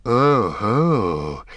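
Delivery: sly goblin oh-ho; hissed oh-ho. A narrator's reading. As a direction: hiss it like a pleased goblin. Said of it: "hissed the goblin softly".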